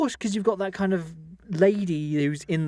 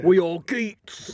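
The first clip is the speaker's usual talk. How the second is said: put-on East End accent; 2 semitones higher, 4 dB louder, much slower than usual. Mimicking actor's line in accent.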